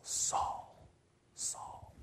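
whispered call of the name, very soft; hushed calling. Acted. Jesus calling to Saul, whispered. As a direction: breathe the name out like a distant call.

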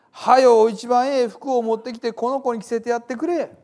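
high, loud, excited orders to servants; overjoyed father. A narrator's reading. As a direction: call out joyfully for a celebration.

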